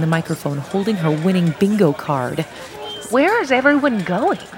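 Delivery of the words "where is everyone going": put-on confused old lady.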